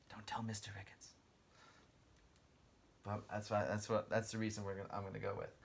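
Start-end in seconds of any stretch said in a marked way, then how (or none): 0.1-1.1 playful stage whisper; conspiratorial whisper to students. Pretend. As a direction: whisper it like a secret to the class. Teacher jokes secret from principal.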